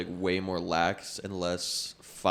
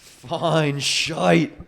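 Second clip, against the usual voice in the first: loud, high Irish "fine shite"; exaggerated Irish accent, pitched up; mock Irish accent; 8 semitones higher, 8 dB louder than usual. Shown as a demonstration. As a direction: belt it out in a put-on Irish brogue. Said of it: "he's Irish".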